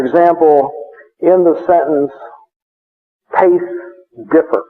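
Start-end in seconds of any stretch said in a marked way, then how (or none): 3.4-4.6 Tastes, long pause, differ; two separate spoken words. Shown as a demonstration. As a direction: set each word apart with a pause. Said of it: "Two words".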